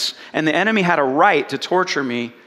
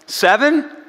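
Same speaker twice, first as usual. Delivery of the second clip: hopeful, high rising question; eager guess; 6 semitones higher than usual. Retold or imitated. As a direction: offer the number like a keen pupil. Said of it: "Jesus replies".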